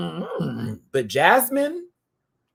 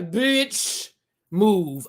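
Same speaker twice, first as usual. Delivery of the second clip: sassy dismissal; 6 semitones higher than usual. Pretend.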